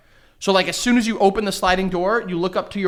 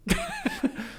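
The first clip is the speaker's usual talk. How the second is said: sudden shrill laugh; surprised laugh, squealing high; 9 dB quieter than usual. Real, not acted. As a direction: burst out laughing in disbelief. Laughs at wife's accusation.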